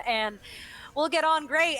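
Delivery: usual speaking voice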